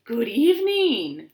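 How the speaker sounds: whirring dragonfly. Acted. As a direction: whir the greeting like a gliding dragonfly. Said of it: "whirred a dragonfly".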